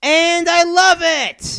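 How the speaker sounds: high, loud, drawn-out cry of love; shouted enthusiasm, stretched out; gleeful shout. Real, not acted. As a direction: belt it out slow and high. Gleeful outburst about the game.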